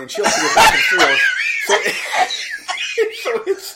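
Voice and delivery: fast, high, laughing; bursting into laughter mid-line. Real, not acted.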